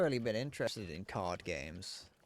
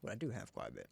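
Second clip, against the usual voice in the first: talking around chocolate; words mushy with chocolate in mouth; mouth full; 5 semitones lower, 7 dB quieter, much faster than usual. Real, not acted. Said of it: "I've got chocolate stuck in my mouth".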